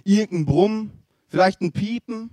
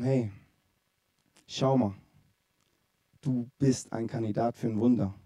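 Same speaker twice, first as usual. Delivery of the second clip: soft gentle encouragement; 7 semitones lower, 9 dB quieter than usual. Acted. Friends gently telling him.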